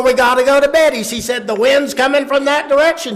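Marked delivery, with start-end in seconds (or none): 0.0-1.1 fast, high, loud urging; insistent mother. Retold or imitated. 1.5-3.2 quick, high, loud retort; yelling father. Retold or imitated. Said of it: "don't yell at me".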